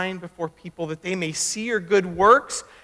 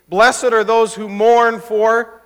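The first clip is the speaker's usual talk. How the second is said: leading recitation; 2 semitones higher, 7 dB louder, much slower than usual. Shown as a demonstration. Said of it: "See, you can do it".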